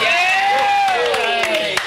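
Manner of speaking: high, loud cry of joy; a real burst of victory delight; excited cheer. Real, not acted. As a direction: whoop at the win. Boys' kitchen wins the score.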